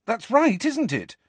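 high, pressing question; urging. A narrator's reading. Harry urges Nick to confirm it, insistent. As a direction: push him for a yes. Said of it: "Harry urged him".

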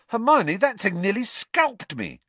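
high, loud complaint; indignant protest. Acted. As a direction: protest in outrage to a friend. Indignant protest after cat attack.

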